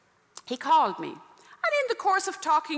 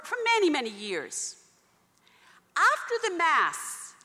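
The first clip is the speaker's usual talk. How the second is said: normal talking voice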